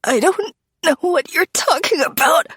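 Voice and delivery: high, strangled and terrified; choked out. A narrator's reading. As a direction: force it out through a choked throat. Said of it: "I choke out through my clenched throat".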